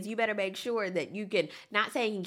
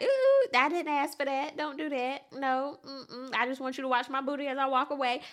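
higher, playful sassy brush-off; sassy woman quote; 5 semitones higher than usual. Retold or imitated. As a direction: turn him down sweetly but firmly. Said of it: "a woman's like".

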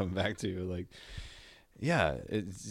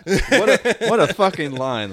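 high, loud laugh breaking into praise; delighted burst over a great lyric; laughing excitement; 10 semitones higher, 10 dB louder than usual. Real, not acted. Host raves about a lyric he loves, laughing loudly.

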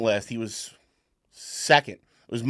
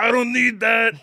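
imitating father-in-law; 10 semitones higher, 4 dB louder than usual. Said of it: "Is how he talks".

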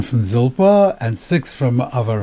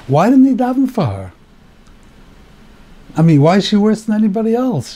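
high-pitched, indignant disbelief; puzzled protest in a high voice; indignant questioning; 7 semitones higher than usual. Real, not acted. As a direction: demand an explanation, pitch raised. Teacher puzzles indignantly over the story.

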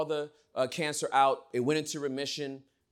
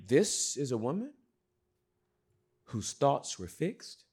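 usual speaking voice

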